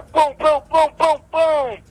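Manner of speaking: punchy high booms, slowed down; boom sound effects. Retold or imitated. Fighter reliving his flurry, high mock punches.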